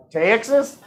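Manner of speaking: high, badly faked Texas accent on TEXAS; questioning, hammed-up Texas accent. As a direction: another poor stab at a Texas twang. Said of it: "That was like the exact same thing".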